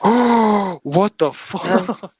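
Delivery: gasp, then slow stunned disbelief; scandalised, drawn-out reaction; real shock. Real, not acted. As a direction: gasp and drag the words out, appalled. Shocked reaction to dark joke.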